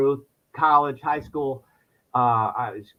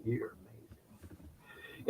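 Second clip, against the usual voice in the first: whispered to the mirror, slow; a slow secret compliment to the mirror; hushed self-praise; 3 semitones lower, 21 dB quieter, much slower than usual. Retold or imitated. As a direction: breathe it softly at yourself.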